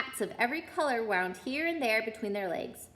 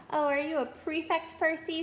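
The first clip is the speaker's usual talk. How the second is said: a touch higher, faked amazement; mock surprise; 6 semitones higher than usual. Twin teases Percy, lightly mock surprised. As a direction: pretend to be astonished. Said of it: "with an air of great surprise".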